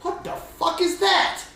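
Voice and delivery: high, slow, shocked cry; startled outburst. Acted. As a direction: recoil at the sight of the cat. Targaris sees the cat.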